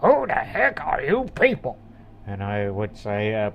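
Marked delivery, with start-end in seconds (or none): 0.0-1.8 put-on grumpy old trader. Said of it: "He'll be like".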